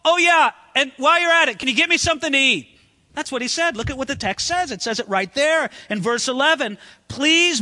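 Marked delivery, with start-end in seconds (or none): 0.0-2.7 louder, higher, offhand tacked-on request; casual cheeky add-on. Retold or imitated. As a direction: toss it off like a cheeky afterthought. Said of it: "he adds something outrageous".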